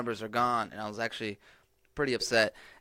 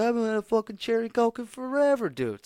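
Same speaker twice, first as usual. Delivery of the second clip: much higher and louder, overjoyed outburst; excited shout; 12 semitones higher, 6 dB louder than usual. Retold or imitated. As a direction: shout it like you just found treasure. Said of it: "I was like".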